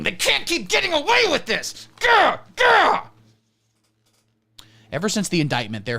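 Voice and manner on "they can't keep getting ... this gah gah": high, loud ranting yell; screaming rage. Retold or imitated. Enraged viewer punching drywall, high furious yell. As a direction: shriek it like an enraged fan. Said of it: "Stop screaming".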